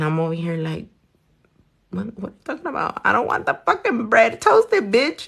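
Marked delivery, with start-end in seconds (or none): 1.9-5.3 pitched up, quick and exasperated; mock indignation. Retelling how she snapped back at the rude Subway girl, mock outraged. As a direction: act out the outrage at the cashier. Retold fight with rude cashier.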